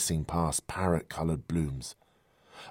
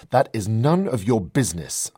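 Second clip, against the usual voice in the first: sharp, loud, higher snap of offense; snapped; 6 semitones higher, 9 dB louder than usual. Acted. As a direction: bite the words off, stung and defensive. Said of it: "I snapped".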